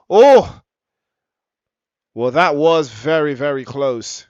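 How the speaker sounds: sudden high, loud gasp-shout; near-miss shout. Real, not acted. Near miss called live.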